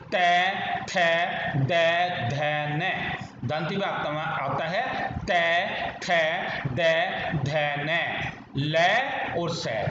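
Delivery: tongue on teeth for each letter; dental sounds demo. Shown as a demonstration. Teacher reviewing the dental letters. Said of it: "अब आते हैं दंत पर".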